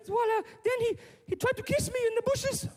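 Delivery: put-on very high little-girl voice; upset daughter. Daughter telling dad about a man, high upset voice. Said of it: "Mike's daughter comes home from school".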